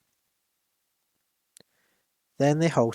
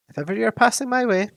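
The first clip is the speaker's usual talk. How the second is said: Bilbo's invitation; 10 semitones higher, 4 dB louder, faster than usual. Acted.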